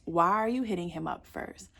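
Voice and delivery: ordinary delivery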